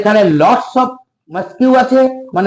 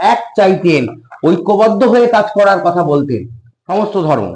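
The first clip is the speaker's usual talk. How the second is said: ordinary delivery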